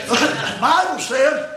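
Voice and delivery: preacher calling out scripture to the room; preaching. Acted. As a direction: preach the verse like a revival.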